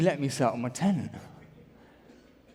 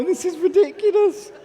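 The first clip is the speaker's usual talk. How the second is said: pitched way up in disbelief; exasperated; 12 semitones higher, 5 dB louder than usual. Real, not acted. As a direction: throw up your hands, this is absurd. Exasperated at absurd suggestions.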